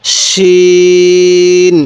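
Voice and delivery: শিন held very long with a full thick sh; thick shin. Shown as a demonstration. Teacher models Arabic shin, thick and very slow. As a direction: draw out শিন heavy and thick. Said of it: "এই শিনের আওয়াজটা একটু মোটা করে করব".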